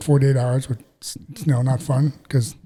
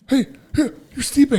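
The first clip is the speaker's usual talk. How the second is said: much higher, breathless fan squeal; star-struck fan; 12 semitones higher than usual. Retold or imitated. Young man at the call recognizing the champ, excited high squeal. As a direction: gush like you just spotted a celebrity.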